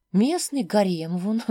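usual speaking voice

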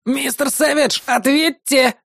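high, strained plea over comms; Isamu, agitated. A narrator's reading. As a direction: beg anxiously for a response. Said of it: "взволнованный голос Изаму".

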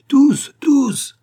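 loud, very high cheer; shouting applause. A narrator's reading. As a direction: shout it out over your own clapping. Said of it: "applaudissait bruyamment et criait".

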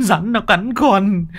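high slow wail that drops on "nó"; falling wail. Acted. Tâm repeats his cry to uncle, falling wail. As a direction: sink the repeat with a falling whine.

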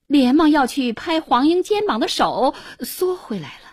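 ordinary delivery

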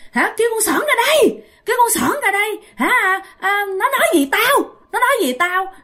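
high, fast strange screaming; possessed girl screaming. Retold or imitated. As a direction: screech like the ghost demanding Sỏn. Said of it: "nó la làng lên". (hồn ma gào thét đòi gặp Sỏn)

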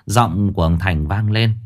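ordinary delivery, nothing marked

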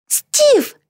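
high, delighted call; joyful cry. A narrator's reading. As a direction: call out to a friend with high-pitched joy. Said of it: "обрадовалась Битти". (радостный возглас высоким голосом)

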